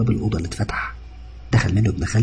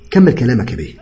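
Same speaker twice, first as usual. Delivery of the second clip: louder, higher sarcastic challenge; angry demand; 4 semitones higher, 6 dB louder than usual. A narrator's reading. Patient confronting cousin before the doctor, angry demand. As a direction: demand an explanation, indignant.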